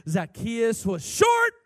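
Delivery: shouted, high, slow sermon climax; full-force preaching. Acted. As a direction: build to a loud, stretched-out preacher's shout.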